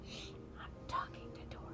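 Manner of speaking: hushed whisper, shushing the others; breathy secret whisper, don't disturb. Acted. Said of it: "Why are you whispering?".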